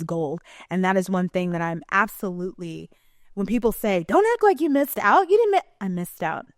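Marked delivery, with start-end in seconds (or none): 4.1-5.7 high, loud mock scolding; mimicking critics. Critics telling her not to act deprived, high mimicking. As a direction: imitate people telling you off, pitched way up.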